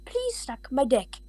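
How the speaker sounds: ordinary delivery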